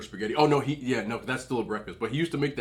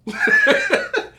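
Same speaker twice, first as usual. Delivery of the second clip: high burst of laughter; loud laugh; 10 dB louder than usual. Real, not acted. Vlogger laughing at vowing revenge on mom, loud laugh. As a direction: cackle at your own threat. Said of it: "I'm coming after you".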